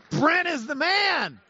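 high, loud shout of praise; excited cheer. Real, not acted. Preacher hails the helper fixing his slides, excited cheer. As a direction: cheer him on, drawing out the last word.

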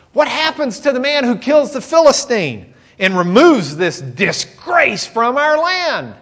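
high and loud, slowing at the end; eager, earnest question. Acted. David asking the men about the reward, fervent. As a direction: press the question with zeal. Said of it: "For him, it's a no-brainer".

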